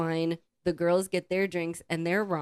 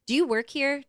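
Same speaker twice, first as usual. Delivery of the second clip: checking if he's staff, voice raised; pointed question; 9 semitones higher, 3 dB louder than usual. Retold or imitated. To the stranger at the table, a raised pointed question. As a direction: ask it sharp and suspicious, pitched up. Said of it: "Just in case our attitude, you know, volume".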